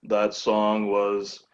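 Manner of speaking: low, very slow radio DJ impression; Stephen Wright. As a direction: drag it out in a deadpan low monotone. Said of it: "do my best Stephen Wright impression".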